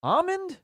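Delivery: ALMOND as AH-mund in a very high pitch. Real, not acted. Host rejecting the rule, ALMOND queried very high as AH-mund. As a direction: squeak out ALMOND without L, incredulous. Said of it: "I don't even think I've heard that".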